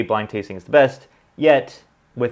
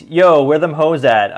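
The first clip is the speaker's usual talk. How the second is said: loud, higher, drawn-out comment read; mock calling out; 6 semitones higher, 8 dB louder, slower than usual. Reading a viewer's joking comment to the audience, mock calling out. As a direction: call it out slowly and loudly, hamming it up.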